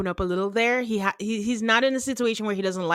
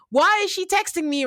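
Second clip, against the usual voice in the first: put-on a half-hearted stab at Cameron's accent, pitched higher; failed Cameron accent; 7 semitones higher than usual. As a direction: try Cameron's accent and fall short. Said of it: "Or I can't do the accent".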